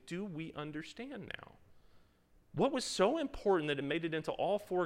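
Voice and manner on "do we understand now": a hint of an unsure disciple, softer; puzzled disciple. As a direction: ask it as a confused follower would. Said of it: "playing the part of the disciple".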